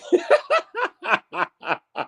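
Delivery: long high-pitched burst of laughter; real laugh. Real, not acted. Laughing at a chat comment.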